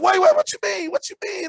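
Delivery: high, loud panicked pleading. Retold or imitated. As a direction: blurt it out in a panic. Panicked reply to girlfriend.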